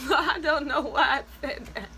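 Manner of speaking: words broken by laughter; laughing. Real, not acted. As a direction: laugh at yourself mid-sentence. Laughing at herself.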